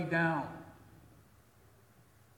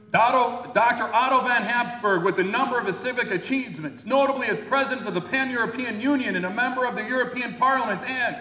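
higher, louder listing of honours; proud proclamation; 7 semitones higher, 6 dB louder than usual. Retold or imitated. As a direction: announce it with pomp. Second proclamation of achievements.

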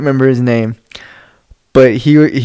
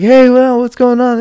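high-pitched mock chatting-up; imitated breezy greeting; 10 semitones higher than usual. Chris chatting to the waitress, imitated breezy hello. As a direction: play the easy talker, pitched way up.

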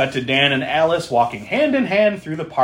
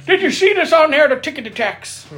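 high, hammed-up question; mock-clueless joke voice; 14 semitones higher, 4 dB louder than usual. Host playing along with coworker joke, high mock-clueless voice. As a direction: pitch it way up as a comic out-of-touch asker.

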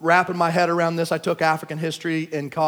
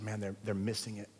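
hushed, much softer aside; said quietly; 8 semitones lower, 17 dB quieter than usual. Retold or imitated. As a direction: murmur it low so only your wife hears. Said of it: "I said quietly to my wife".

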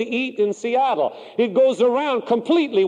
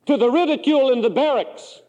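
ordinary delivery

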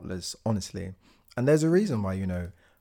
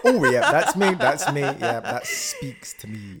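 mock fans; 7 semitones higher, 7 dB louder than usual. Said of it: "people were like".

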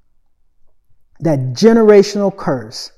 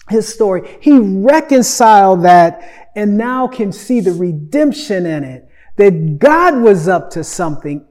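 preaching; 5 semitones higher than usual. Acted. Preaching on Joseph's forgiveness.

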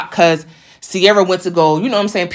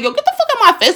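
much higher, re-enacted outburst; retold angry outburst; 14 semitones higher, much faster than usual. Her to the man as she pushes him, re-enacted angry outburst. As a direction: act out shoving someone off, pitched way up. Retelling pushing him away.

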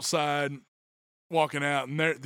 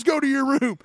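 higher, louder mock command; stern order; 10 semitones higher, 9 dB louder than usual. Grandpa ordering the boy away, louder mock command. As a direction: send the kid off like a strict grandpa. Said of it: "And then he goes".

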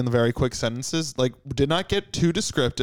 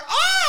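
sudden high mock shriek of fright; mock scream; 3 dB louder than usual. Diner startled by dinosaur at steakhouse, shrieking in mock fright. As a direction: shriek as if a dino just popped up.

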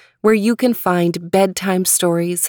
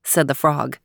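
usual speaking voice

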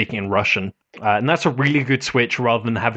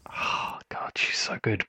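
awed whisper; 3 semitones lower, 11 dB quieter than usual. Real, not acted. Aside while describing Balalaika.

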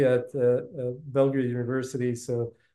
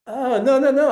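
much higher, louder playful no's; mock protest; 11 semitones higher, 8 dB louder than usual. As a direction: protest in jest, rising each time. Playful mock denial of nerdiness.

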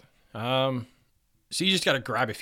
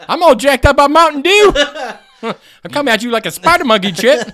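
hyped-up quote; 14 semitones higher, 16 dB louder than usual. Retold or imitated.

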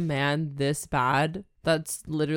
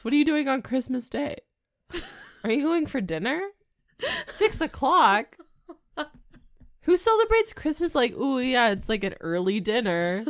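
incredulous; 8 semitones higher than usual. Real, not acted. Incredulous at six o'clock.

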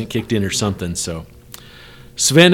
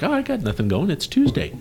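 carefree self; 7 semitones higher, 6 dB quieter than usual. Retold or imitated. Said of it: "I even looked on my calendar like".

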